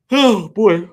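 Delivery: words stretched through a big yawn. Real, not acted. Host yawning mid-update to his viewers. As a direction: talk right through the yawn. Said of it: "I'm yawning right now".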